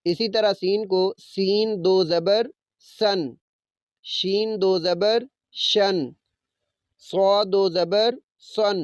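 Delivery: normal talking voice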